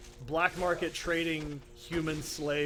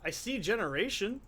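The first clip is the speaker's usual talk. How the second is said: ordinary talk, nothing marked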